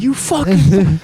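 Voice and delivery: mock road rage. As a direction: imitate cursing out a car window. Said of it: "rolling down the window going".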